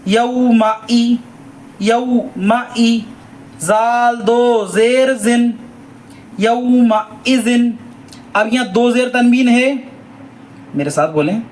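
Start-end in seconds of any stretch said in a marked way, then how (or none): 0.1-7.8 very slow, stretched reading; slow recitation. Shown as a demonstration. Teacher building the word slowly for pupils. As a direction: draw each syllable out for learners. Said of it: "teacher models recitation".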